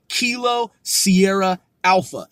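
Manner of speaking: ordinary delivery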